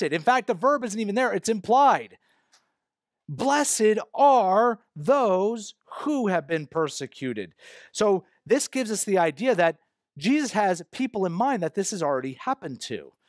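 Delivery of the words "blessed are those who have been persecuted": "Blessed" drawn out high at half speed; slow, weighty scripture quote, opening hard on "Blessed"; emphatic quotation. Shown as a demonstration. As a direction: spell it out slowly, stressing "Blessed". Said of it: "is emphasized".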